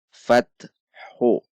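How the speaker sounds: fathu with a drawn-out final vowel; Arabic syllable demo. Shown as a demonstration. Teacher to students: Quran reading demo, fathu drawn out. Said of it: "yaitu fat".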